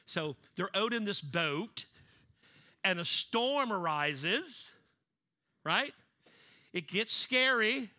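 preaching at full force. Acted. Preacher telling his church of the storm, full force. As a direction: drive it up as the storm hits.

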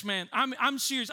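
usual speaking voice

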